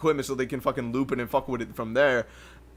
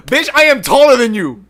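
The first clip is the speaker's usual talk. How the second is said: much louder and higher, laughing; gloating yell; 8 semitones higher, 11 dB louder than usual. Acted. Mock-gloating at the tall friend, a loud laughing yell. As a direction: crow it in triumph. Gloating about being taller.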